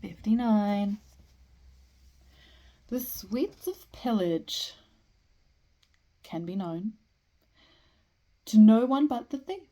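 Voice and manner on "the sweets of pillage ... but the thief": slow, deliberate recital, wide pitch swings; poetry reading. Acted. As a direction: let each line hang before the next. Reads a poem aloud.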